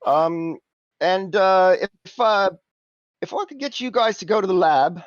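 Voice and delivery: lightly voiced cockney fixer; Simon's cockney voice. GM as Simon pitching the job, lightly cockney. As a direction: haggle in a cocky cockney lilt.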